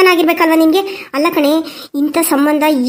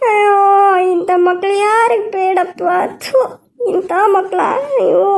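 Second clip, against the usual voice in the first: slow, sobbing lament to God; mother's slow tearful lament; crying; 4 semitones higher than usual. Acted. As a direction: wail it through tears, slowly. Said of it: "ಅಳ್ಬೇಡ, ಸುಮ್ನಿರು, ಯಾಕೆ ಅಳ್ತೀಯ?".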